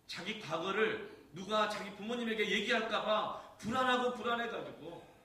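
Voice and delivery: high, loud and slow, preaching at full force; fervent preaching. Acted. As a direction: cry it out from the pulpit. (강단에서 외치듯 크고 높게 설교)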